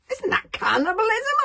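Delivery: high, loud, startled question; a boy appalled at what he hears; shocked boy. Acted. Hiccup shocked that the dragon ate a dragon.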